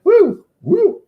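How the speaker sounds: high loud Ric Flair woo; wrestler woo. Pretend. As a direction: whoop like a pro wrestler. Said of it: "I gotta stop doing that".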